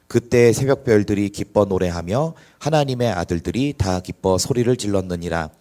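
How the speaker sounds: loud proclaiming Bible reading; booming, steady scripture proclamation. Acted. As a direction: declaim the verses to the congregation. Reads scripture aloud loudly.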